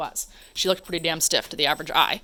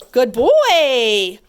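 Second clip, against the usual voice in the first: praise; 4 semitones higher, 10 dB louder than usual. Real, not acted. Praising dog.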